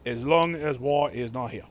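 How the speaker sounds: put-on deliberate, lightly Chinese-accented English; halting attempt at a Chinese accent; formal welcome in a rough Chinese accent. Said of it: "Chinese accent, which I can't do".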